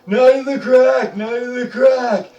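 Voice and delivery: high, very loud slow yell; wild goblin reveller shouting a refrain; drunken goblin. Acted. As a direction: bellow the chant in a drunken stupor. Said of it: "runs by in a drunken stupor".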